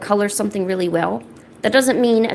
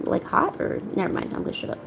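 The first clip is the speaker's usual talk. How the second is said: embarrassed mumble; 8 dB quieter than usual. Real, not acted. Trails off embarrassed on stream.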